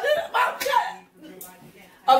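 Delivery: high, wailing whine, half laughing. Real, not acted. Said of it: "I just sounded like a big baby".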